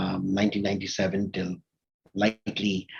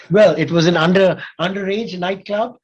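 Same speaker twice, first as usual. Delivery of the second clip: bursting into a sheepish laugh, higher and louder; laughing sheepishly; 8 semitones higher, 8 dB louder than usual. Real, not acted. Guest owning up to his teenage nightclub venture to the host, laughing. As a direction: laugh it out like a slightly embarrassed confession.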